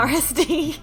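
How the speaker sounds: ordinary talk, nothing marked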